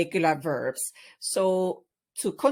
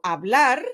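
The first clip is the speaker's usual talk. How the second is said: "hablar" with the final "blar" higher and louder; stress on last syllable; 4 semitones higher, 7 dB louder than usual. Shown as a demonstration. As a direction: punch the end of "hablar", pitch rising. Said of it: "stress falls on the last syllable".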